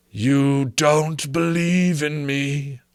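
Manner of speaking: high, very slow, eerie ghost; slow ghostly voice. Acted. Marley's ghost challenging Scrooge, slow and eerie. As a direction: draw it out from beyond the grave.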